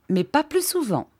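high-pitched, firm condition; formal, rule-setting aunt. A narrator's reading. Miss Clarissa lays down rules.

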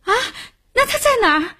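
high-pitched anxious surprise; startled, urgent question. A narrator's reading. Wife learning husband is released, startled and urgent. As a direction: blurt it out high, desperate to know.

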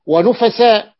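ونفساء loud and high, as nu-fa-sa; ونفساء pronounced emphatically with u on the nun; u then a. Shown as a demonstration. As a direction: ring out the u-then-a vowels in ونفساء. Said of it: "بضم ففتح".

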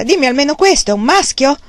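excited call through the door; eager shout. A narrator's reading. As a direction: demand the answer, bursting with hope. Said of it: "gridò un'altra volta".

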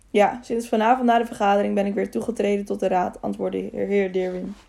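ordinary delivery, nothing marked

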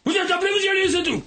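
high shouted scolding. Acted. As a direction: bark it at the old folks. Ranting at old people, scolding.

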